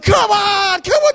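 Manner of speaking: shouted, high cheering from the roadside; crowd shouting. Retold or imitated. Roadside crowd urging the runner on, shouting. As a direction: yell it like fans urging a runner. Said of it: "they're all going".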